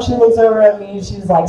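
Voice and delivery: ordinary talk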